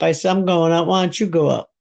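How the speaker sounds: usual speaking voice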